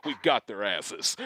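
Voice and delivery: low, soft, slow conspiratorial gloat; sly gloat. Pretend. Finalists gloating over fooled houseguests, hushed and sly. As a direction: let the secret slip out under the breath.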